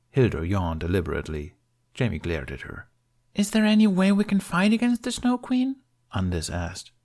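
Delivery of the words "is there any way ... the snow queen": put-on higher childlike voice; child voice. A narrator's reading. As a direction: ask it like an eager kid.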